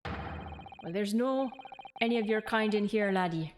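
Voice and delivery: dismissive put-on Scottish governor; rough Scottish accent. Governor turning visitors away from the prison, rough Scottish accent. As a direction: send them packing in an amateur Scottish accent. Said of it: "they write it as, like, a Scottish person".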